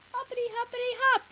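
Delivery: very high, loud, bouncy chant; excited little boy. A narrator's reading. Boy in frog costume at fruit stand, gleeful. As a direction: bounce each word out like a hopping child. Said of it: "And he says".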